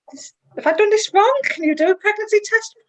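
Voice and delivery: high-pitched, reenacted bewildered questioning; retold disbelief. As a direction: replay your stunned doubt, voice jumping up high. Retelling disbelief at positive test.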